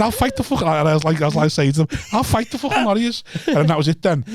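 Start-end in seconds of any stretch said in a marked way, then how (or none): 0.0-0.6 retold threat. Retells threat to rugby crowd. 2.1-3.2 high-pitched, comic challenge to a whole crowd; retold threat. Retells threat to rugby crowd.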